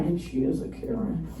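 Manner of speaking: ordinary talk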